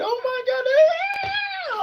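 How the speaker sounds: very high shrieking oh my God. Retold or imitated. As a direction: ham up an overexcited woman. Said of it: "They be like".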